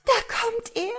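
gasp, then very high-pitched alarm; frightened cry. A narrator's reading. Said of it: "schrie die Frau". (erschrockener, hoher Aufschrei der Frau)

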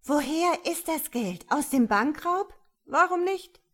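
high, loud, incredulous questioning; agitated woman. A narrator's reading. Woman confronting policeman about the money, agitated.

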